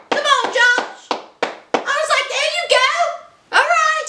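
high, egging-on encouragement; cheering him on. Retold or imitated. Host urging Josh to break up, cheering him on. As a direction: pitch it up as if rooting from the sidelines.

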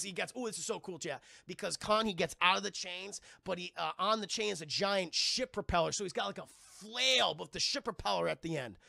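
ordinary talk, nothing marked

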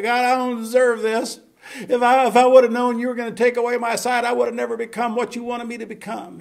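high, loud, wailing complaint; mock protest to God. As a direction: cry out in outraged self-pity. Acted complaint Paul never made.

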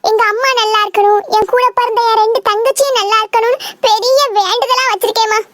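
ordinary talk

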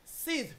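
teacher demonstrating sixth; F ending demo. Shown as a demonstration. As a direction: close the mouth at the end of "sid". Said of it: "La F, sif".

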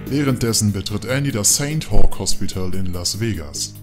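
usual speaking voice

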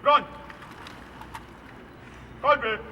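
shouted salute orders; high ringing parade commands; military command. Real, not acted. (wykrzykiwane komendy salwy)

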